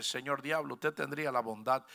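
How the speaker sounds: very soft, overly polite plea to the devil; mock politeness. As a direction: ask the devil sarcastically nicely. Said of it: "Usted tiene que decirle".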